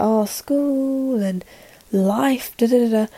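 soft, slow, drawn-out excuses; mock excuse-making. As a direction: trail through excuses in a mock lament. Mimics own excuses for delaying.

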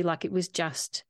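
ordinary talk, nothing marked

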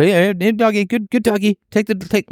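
high, sing-song coaxing of a pet dog. Retold or imitated. Man coaxing dog with drug.

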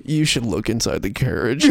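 low, creepy magician hint; creepy David Blaine. Acted. David Blaine to a parent with a baby, low and creepy. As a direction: drop the pitch and hint creepily. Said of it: "Yeah, in his creepy voice".

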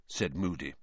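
ordinary delivery, nothing marked

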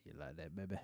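low, very soft come-on; hushed, deep dirty-talk voice; bedroom talk voice. Acted. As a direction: murmur it low like mock pillow talk. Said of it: "Is that the voice you use".

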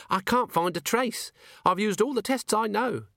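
high-pitched, anxious-to-please underling; Cheery, apologetic dwarf. A narrator's reading. Cheery reports failed tests to Vimes, high and apologetic. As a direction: plead high that every test was tried.

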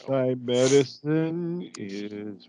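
drawn-out, sleepy sloth imitation; super slow sleepy sloth. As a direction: stretch every word like a sloth. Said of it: "The slowest outro of the podcast".

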